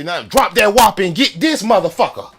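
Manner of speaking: barked order to drop the burger; mock arrest yell. Comic telling off an immigrant at lunch, loud yell. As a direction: shout it like a citizen making an arrest. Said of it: "I'ma say".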